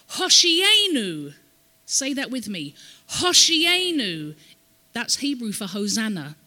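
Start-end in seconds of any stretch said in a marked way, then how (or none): 0.1-1.3 loud, high, drawn-out cry. Acted. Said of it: "they would cry out from Psalm". 3.2-4.4 high, loud chant-like call, stretched long; crying out. Acted. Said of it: "they would cry out from Psalm".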